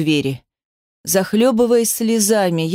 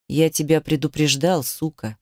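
low, hushed menacing husband; hissed threat; 4 semitones lower, 3 dB quieter than usual. A narrator's reading. Husband to runaway wife, hissing with contempt. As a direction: sink to a low, soft hiss. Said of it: "прошепел он".

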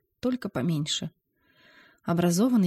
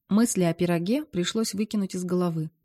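usual speaking voice

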